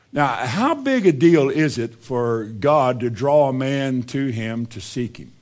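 ordinary delivery

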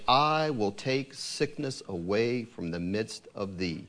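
measured, solemn reading of scripture; steady, reverent Bible reading. Acted. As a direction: read it like holy writ, deliberate. Reads scripture passage aloud.

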